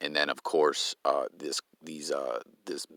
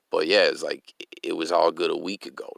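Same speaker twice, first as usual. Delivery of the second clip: ordinary talk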